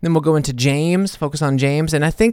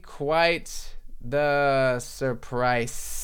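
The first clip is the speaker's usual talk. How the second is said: very slow, hushed; slow soft title; 7 dB quieter, much slower than usual. Shown as a demonstration. Hushed, slow naming of the title for listeners. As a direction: savour each word softly. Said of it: "that's gonna be the title, baby".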